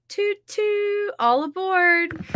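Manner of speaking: high playful toot-toot call; mock train conductor. To writing chat, a playful mock train conductor call. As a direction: chirp it like a cheery conductor on the struggle bus. Joking about the struggle bus.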